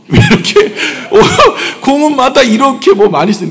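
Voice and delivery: high laughing disbelief. Real, not acted. Laughing at the slogan stones.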